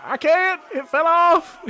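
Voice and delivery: very high, loud whine; whiny bartender. Acted. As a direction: whine helplessly. Bartender answers Tex.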